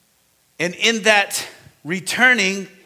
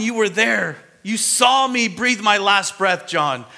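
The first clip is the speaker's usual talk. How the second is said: Jesus pleading with John; 6 semitones higher than usual. Acted.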